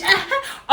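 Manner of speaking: quick high burst of laughter; short laugh. Real, not acted. Amused at her own retold insult, laughing.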